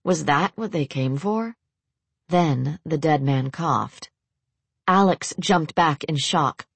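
ordinary delivery

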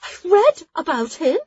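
high, loud excited squeak; squeaky excited. Acted. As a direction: blurt it out starstruck. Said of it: "Jula almost squeaked".

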